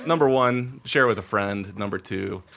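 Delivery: ordinary talk, nothing marked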